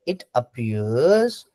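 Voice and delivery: "it appears" drawn out very slowly, rising on appears; slow stress on appears. Shown as a demonstration. Teacher to students, slowly stressing appears. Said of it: "अब यहाँ पे ध्यान से सुनो".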